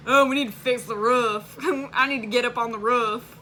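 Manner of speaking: a light Southern twang, roof with a short oo; laughing through a Southern-accented impression of dad; mock Southern accent. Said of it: "you said that very Southern".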